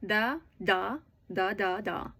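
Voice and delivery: "da" beats, two then three; spaced spoken "da" syllables in place of words; rhythm demo. Shown as a demonstration. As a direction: beat out the sentence rhythm on "da". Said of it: "The rhythm goes".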